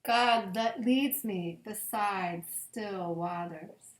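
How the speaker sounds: slow, reverent psalm reading with long pauses. Acted. Pastor reading Psalm twenty-three at bedtime, slow and reverent. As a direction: let each verse land gently, slowly.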